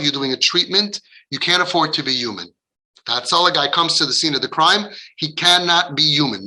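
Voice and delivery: normal talking voice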